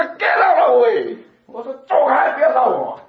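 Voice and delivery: high, loud yelling through the closed door; retold shout. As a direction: drive him away from the door, shouting. (关着门对敲门的老板大声吼)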